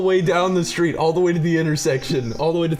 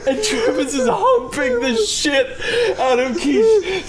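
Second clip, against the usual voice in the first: high, louder and slowed, breaking into tears of laughter; cry-laughing; 4 dB louder than usual. Real, not acted. Teller describes the friend's prank, laughing to tears. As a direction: fight through the laughter to finish.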